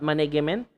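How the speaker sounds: management said with a hard g, like manag-ement; wrong hard g. Shown as a demonstration. Teacher demonstrating the wrong way to say management. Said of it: "That is not the correct way".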